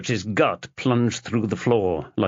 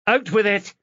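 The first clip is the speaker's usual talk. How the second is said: impatient demand; 11 semitones higher than usual. Acted. Chloe arms folded, impatient.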